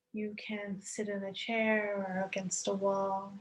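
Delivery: ordinary talk, nothing marked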